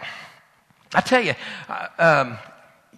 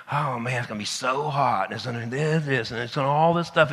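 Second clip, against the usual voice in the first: lower, softer dreading grumble; reluctant grumble; 7 semitones lower, 5 dB quieter than usual. Retold or imitated. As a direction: groan it out like you don't want to go. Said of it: "I sure was reluctant".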